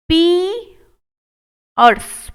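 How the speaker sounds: Piers drawn out very slowly; slow model take. Shown as a demonstration. Teacher modelling piers slowly for students. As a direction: stretch the ee in piers. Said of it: "मेरी तरह बोल कर देखिएगा".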